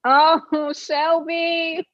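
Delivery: high-pitched, drawn-out mock cry; film character impression. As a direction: call it out as a hammed-up movie quote. Acting out film line from Magnolias.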